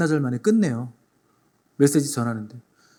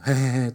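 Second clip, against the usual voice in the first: giggle; 3 semitones lower, slower than usual. Retold or imitated. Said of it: "또 웃고 있습니다".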